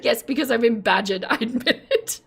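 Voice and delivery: words dissolving into giggles; real amused confession ending in laughter; laughing admission. Real, not acted. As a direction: own up while starting to crack up.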